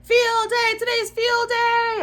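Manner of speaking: high, loud joyful shouting; happy shout. Acted. Junie B. shouting happy news to her dog, loud. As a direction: shout the news with pure glee. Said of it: "I shouted to my dog".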